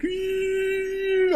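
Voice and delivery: very high excited squeal; tiny high-pitched squeak of glee. Retold or imitated. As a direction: squeal like a thrilled fangirl. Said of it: "I was getting all excited".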